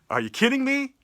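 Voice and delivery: high, disbelieving are you kidding me; outraged disbelief at the contract; incredulous. Real, not acted. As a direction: throw the question up in disbelief. Incredulous rant about contract.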